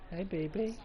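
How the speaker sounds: high, soft coo. Real, not acted. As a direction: greet the cat sweetly. Greeting his cat.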